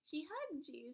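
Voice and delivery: normal talking voice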